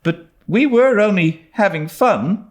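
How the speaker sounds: put-on high, insistent girl; persistent. A narrator's reading. Anne to Miss Barry, persisting. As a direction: hold her ground. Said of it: "persisted Anne".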